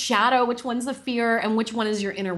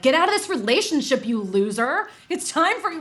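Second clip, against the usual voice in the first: higher, contemptuous sneer; inner mean girl; 7 semitones higher than usual. Shown as a demonstration. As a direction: spit it out like a scornful bully. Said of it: "your inner mean girl is gonna say it like".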